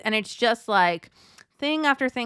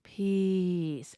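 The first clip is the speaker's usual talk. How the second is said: calming word; 3 semitones lower, 5 dB quieter than usual. Shown as a demonstration. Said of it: "I like to say in my mind the word peace".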